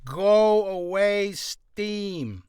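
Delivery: loud, high, very slow call; drawn-out shooing. Real, not acted. Streamer shoos an interrupting Steam notice, drawn-out call. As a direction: stretch each word out to shoo it off.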